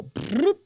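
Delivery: a high, loud "bloop"; imitated phone blip. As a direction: pop it out like a phone line lighting up. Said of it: "the phone lines go".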